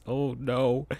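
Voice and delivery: plain reading voice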